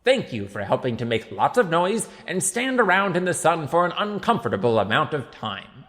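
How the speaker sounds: loud, high public-speech voice; mayor addressing a crowd. A narrator's reading. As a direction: project grandly to the back of the square.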